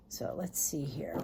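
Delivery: murmured aside while shuffling, whispered. Real, not acted. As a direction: breathe it out under her breath. Musing aloud while shuffling cards.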